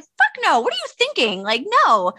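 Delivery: high, loud, disbelieving no; incredulous refusal. Retold or imitated. Mom quoting her refusal to her teenage son, high loud disbelief. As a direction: slam the idea down in disbelief. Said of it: "I was just like".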